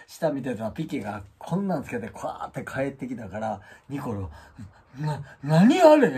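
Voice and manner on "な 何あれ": high, loud and slow alarmed gasp; slow shocked question; startled cat. Retold or imitated. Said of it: "みたいになったよな".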